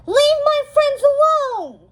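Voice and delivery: high, loud defiant shout; fierce cry. Acted. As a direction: stand your ground and shout it. Mal faces the dragon.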